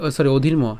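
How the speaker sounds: ordinary delivery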